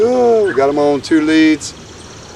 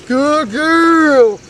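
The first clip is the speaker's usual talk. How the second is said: pitched way up, bright praise for the dog; high praise voice; 11 semitones higher than usual. Real, not acted. Praising the dog after heel.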